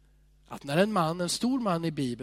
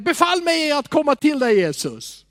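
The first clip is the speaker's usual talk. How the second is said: loud, higher, eager plea; eager shout; 10 semitones higher, 9 dB louder than usual. Retold or imitated. Peter calling from the boat, loud and eager. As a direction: call out boldly to Jesus. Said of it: "säger han".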